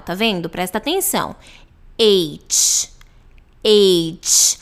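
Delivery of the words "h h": "H" as eitch, ending in a strong ch. Shown as a demonstration. Teacher contrasting H with eight, emphatic final ch. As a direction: punch "H" and release a full ch. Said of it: "ela tem esse ch no final".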